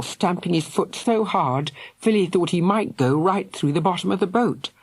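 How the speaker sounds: ordinary delivery, nothing marked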